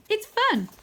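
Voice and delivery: put-on high, bouncy boy voice; eager and high; Wyn's voice. Wyn urging Arthur to start jumping, high eager voice.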